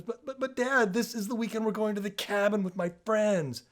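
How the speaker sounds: whiny son. Retold or imitated. Son whining to his dad about the cabin weekend. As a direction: beg like a teen about to lose his trip. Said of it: "And the son goes".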